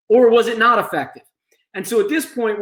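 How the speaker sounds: ordinary talk, nothing marked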